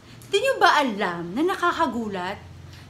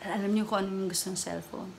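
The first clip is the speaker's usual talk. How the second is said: normal talking voice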